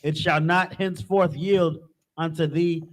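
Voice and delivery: usual speaking voice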